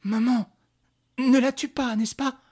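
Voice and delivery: put-on high-pitched anxious boy voice; pleading young son. Son begs his mother not to kill, pleading. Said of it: "murmura François".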